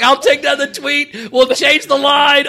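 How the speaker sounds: high, loud, slow frantic backpedal; panicked promise. Retold or imitated. Comic promising to delete the tweet, panicked shout. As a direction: rush to fix it, nearly shouting. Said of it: "I'm like".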